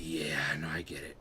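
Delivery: low hushed whisper; defeated whisper. Retold or imitated. To his ex after she says it won't work, resigned whisper. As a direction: breathe it out, resigned. Accepting ex's final rejection.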